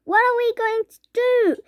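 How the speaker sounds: high, loud despairing wail; helpless mother. A narrator's reading. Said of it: "wailed Missus T V".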